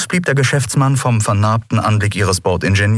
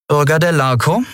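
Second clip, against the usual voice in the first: Kapitänin, misstrauisch; 9 semitones higher than usual. A narrator's reading. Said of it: "mit einem entschieden unguten Gefühl".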